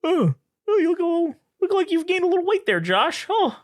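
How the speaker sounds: very high, teasing grandpa impression; mock grandpa. Grandpa to grandson about his weight, high mocking voice. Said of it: "my grandpa used to tell me".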